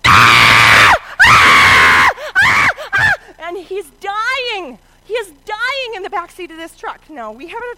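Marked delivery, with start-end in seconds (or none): 0.0-3.1 very loud repeated mock scream; imitated screams of a terrified child. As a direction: shriek in bursts, as if dying. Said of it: "this room goes like this".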